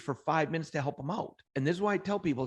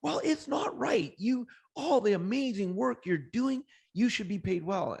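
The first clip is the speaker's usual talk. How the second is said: indignant insisting; 8 semitones higher than usual. Retold or imitated. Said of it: "He goes".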